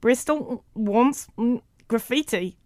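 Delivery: slow, high, halting recorded words; word by word with machine hums between; spliced tape playback. A narrator's reading. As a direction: imitate a jerky edited tape. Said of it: "from Carl's tape recorder".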